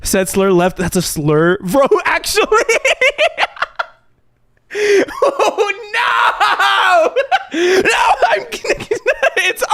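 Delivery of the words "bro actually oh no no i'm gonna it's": cracking up, voice jumping high; laughing. Real, not acted. Laughing at slur clip.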